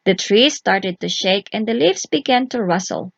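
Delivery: plain reading voice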